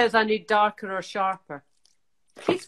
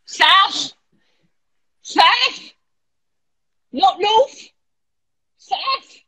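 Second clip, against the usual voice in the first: 1 playful sing-song mocking; 8 semitones higher, 6 dB louder, much slower than usual. Real, not acted. Mocking the north south instruction.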